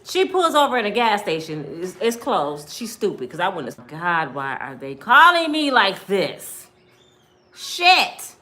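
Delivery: ordinary talk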